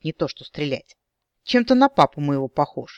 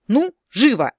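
shouting; 8 semitones higher, 6 dB louder than usual. Acted. Hostage-taker shouting orders.

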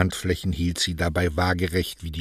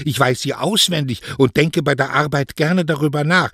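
put-on higher, insistent young woman's retort; defiant daughter; 9 semitones higher, 7 dB louder than usual. Daughter defends her suitor's words to mother, defiantly. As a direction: stand up for his words, pitch raised.

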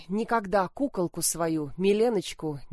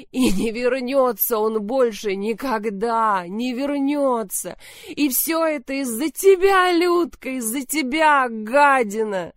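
wild sobbing; 5 semitones higher, 5 dB louder than usual. Acted. Milena sobs wildly, blaming Lyudmila. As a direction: wail and blame her sister. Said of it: "зарыдала диким ... хриплым голосом".

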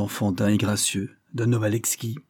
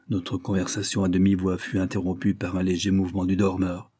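usual speaking voice

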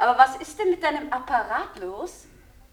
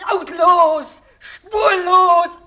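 loud, higher, slow burst with a laugh; laughing shout; 5 semitones higher, 9 dB louder, much slower than usual. Acted. Woman raging at the telephone as a weapon, laughing shout. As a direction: hurl the words out half-mad. Outburst about the phone.

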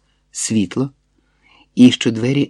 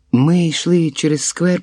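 usual speaking voice

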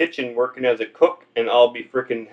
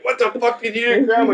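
outraged grandpa; 7 semitones higher than usual. Acted. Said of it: "he's like".

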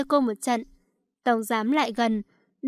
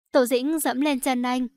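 usual speaking voice

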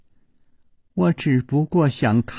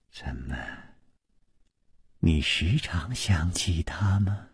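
slow, unvoiced probing whisper; 5 semitones lower, 8 dB quieter than usual. A narrator's reading. 宫南燕盯着熊娘子缓缓低声问，slow whisper. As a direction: whisper the question slowly, watching her. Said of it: "缓缓地说".

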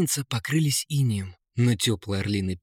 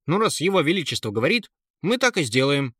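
quick, raised guard's voice; shrugging guards; 4 semitones higher, 3 dB louder, faster than usual. A narrator's reading. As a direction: go along with it briskly, pitched up. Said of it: "пожали плечами стражники". (стражник, быстро и покорно)

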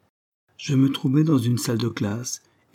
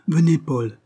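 insistent repeat; 6 semitones higher, 4 dB louder than usual. A narrator's reading. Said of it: "répéta-t-elle".

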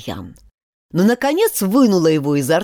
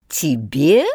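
contemptuous; 4 semitones lower, 3 dB louder than usual. A narrator's reading. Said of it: "проговорила гусеница с презрением".

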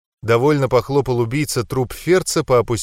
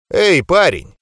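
louder, jaunty hail; cheerful call; 5 semitones higher, 5 dB louder, slower than usual. A narrator's reading. Mentalist taunting the captive boy, cheerful call. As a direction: call out breezily with a wink. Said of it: "весело подмигнул он".

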